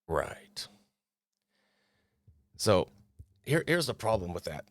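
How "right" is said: breathy sarcastic whisper with no voice; whispered aside. Real, not acted. Creator whispers a dry aside to viewers.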